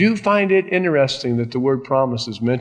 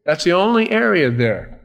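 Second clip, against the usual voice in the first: ordinary talk, nothing marked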